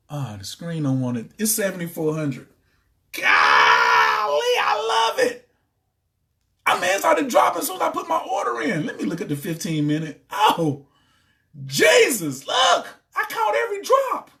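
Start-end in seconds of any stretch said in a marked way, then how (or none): 3.1-5.5 much lower, loud, slow shout of joy; overjoyed shout. Real, not acted. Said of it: "I just got excited". 6.7-8.9 much higher, laughing shout; laughing excitedly. Real, not acted. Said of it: "I just got excited". 11.6-14.3 very high, loud shouting; excited shout. Real, not acted. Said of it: "I just got excited".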